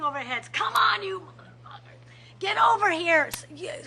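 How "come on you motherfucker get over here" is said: high angry yell with a whispered curse in the middle; retold screaming. Convention delegate screaming over heads at the vote, angry shout. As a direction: yell across the room, then hiss the curse. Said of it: "you had people screaming over Texas".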